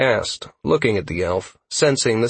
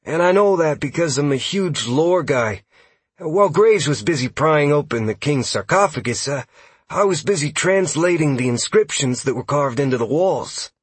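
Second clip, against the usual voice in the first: higher-pitched, keen lore-geek voice; lively, higher young-scout character voice; eager elf scout; 6 semitones higher, 3 dB louder than usual. Acted. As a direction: explain it like an eager lore nerd. Narrator voices elf scout Huxley.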